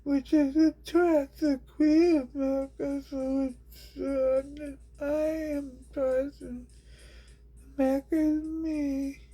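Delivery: very high, odd squeaky rambling; squeaky character voice. Acted. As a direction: ramble in a shrill cartoon voice.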